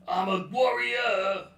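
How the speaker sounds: high, slow, hammed-up warrior shout; mock warrior boast. Joking about Genghis Khan's descendants, a hammed-up slow warrior shout. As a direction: play a boastful warlord, slowly.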